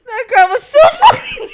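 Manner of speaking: laughing. Real, not acted.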